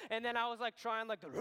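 ordinary talk, nothing marked

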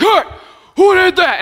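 high, loud, slow mock-angry guy. Driver hopping out of the car, mock-angry call. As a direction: play the mad driver calling out. Guys expected to jump out angry.

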